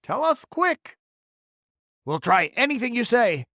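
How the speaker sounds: high loud urgent voices; frantic plea. Acted. Creatures begging James for a plan, frantic. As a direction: press him hard.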